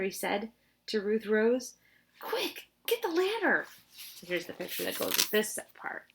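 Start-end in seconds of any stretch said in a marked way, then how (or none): 2.2-3.7 high hurried order; urgent. Acted. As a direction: grab the ladder now. Urgent order to Ruth Rose.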